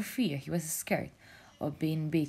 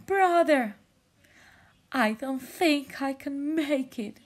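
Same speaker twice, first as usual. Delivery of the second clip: high, slow, faltering plea; weak brother voice; 10 semitones higher, 7 dB louder than usual. Acted. Stranded brother tells jellyfish he can't make it, slow and weak. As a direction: sound tired, barely making it.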